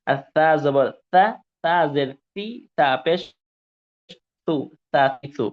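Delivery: teacher contrasting the ta and sin drills loudly. Shown as a demonstration. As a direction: drill the contrast loud and clear. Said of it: "এরকম sound আসবে না".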